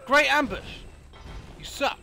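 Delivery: loud, high sarcastic shout; sarcastic yell. Real, not acted. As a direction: jeer sarcastically. Player mocking enemy ambush.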